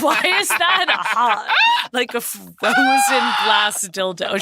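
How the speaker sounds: slow, loud disbelieving yell; incredulous shout. Retold or imitated. Incredulous retort to her friend.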